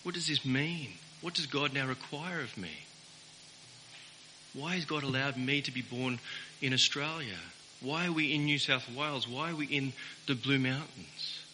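mild searching rich man asking what God wants of him; humble questioning man. Retold or imitated. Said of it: "the man should have said".

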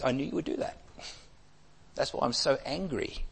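soft, sullen complaint; hushed, bitter I-knew-it; Jonah's quiet resentment. Acted. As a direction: mutter it under your breath at God. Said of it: "And Jonah says".

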